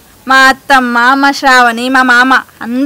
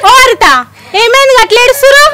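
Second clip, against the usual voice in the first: high, loud and fast calling out; alarmed call; 9 semitones higher, 7 dB louder, faster than usual. Acted. Neighbor calling out in alarm to the crying family. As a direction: shout across to them in alarm.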